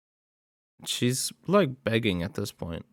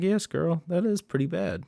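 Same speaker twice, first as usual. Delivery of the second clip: higher, mock-sassy girl talk; pitched-up playful scolding; sassy retort; 7 semitones higher than usual. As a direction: tell her off with a knowing sass. Sassy reply to Nikki.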